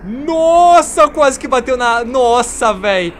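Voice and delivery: high, loud startled yell; real fright at a near crash; shocked shout. Real, not acted. Plane almost crashes.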